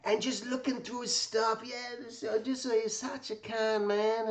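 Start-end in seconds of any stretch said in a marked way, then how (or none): none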